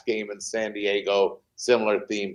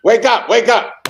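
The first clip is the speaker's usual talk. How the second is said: wake-up call; 12 semitones higher, 10 dB louder than usual. Acted. Calling to students watching class.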